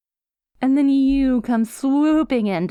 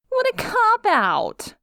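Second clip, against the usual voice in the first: scoffing; 13 semitones higher than usual. Acted. Villain scoffs at rival's motive.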